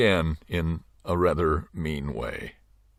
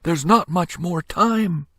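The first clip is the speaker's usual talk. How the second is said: put-on high, slow worried child voice; a boy fearing time runs out; anxious little boy; 10 semitones higher, slower than usual. A narrator's reading. Little boy warning the crowd, high and slow. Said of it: "shouted the little boy".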